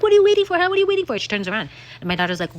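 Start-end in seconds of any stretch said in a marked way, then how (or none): 0.0-1.2 high, loud taunting challenge; imitating the actress. Retelling the movie scene, high loud taunt. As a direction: dare them with arms wide. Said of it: "She's like".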